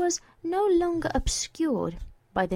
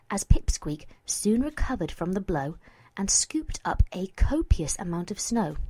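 ordinary delivery, nothing marked

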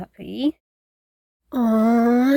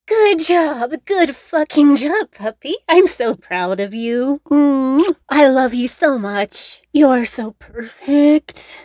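loud, higher burst of proud praise; delighted praise; 4 semitones higher, 6 dB louder than usual. Acted. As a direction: cheer him on like an adored puppy. Praises him for repeating correctly.

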